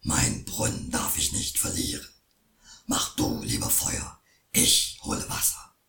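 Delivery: very low, hushed troll; anxious troll. Acted. Troll keeps pleading.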